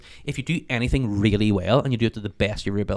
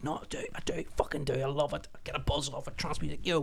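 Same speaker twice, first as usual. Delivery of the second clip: hushed retort; 4 semitones higher, 12 dB quieter than usual. Retold or imitated. His reply to family.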